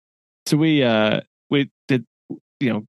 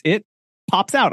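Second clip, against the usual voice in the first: a hint of storybook narrator, raised pitch; pause, then lift it like reading to kids; higher, lightly playful read-aloud voice; children's story reading; 7 semitones higher than usual. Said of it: "like I was reading a children's story".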